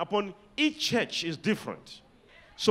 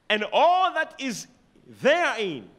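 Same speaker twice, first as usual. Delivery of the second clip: slow, louder, drawn-out scripture reading; slow solemn proclamation; 3 semitones higher, 5 dB louder, slower than usual. Shown as a demonstration. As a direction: declaim each word slowly from the pulpit. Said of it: "underline the word".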